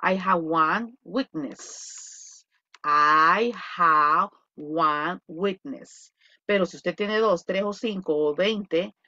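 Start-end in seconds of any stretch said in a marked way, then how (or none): 0.0-2.4 "I have one weakness" very slowly and clearly; teacher dictating the singular form slowly; model sentence. Shown as a demonstration. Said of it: "se dice". 2.8-6.1 "I have one weakness" again, very slowly with lowered pitch; second slow model, a bit lower; repeat slowly. Shown as a demonstration. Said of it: "se dice".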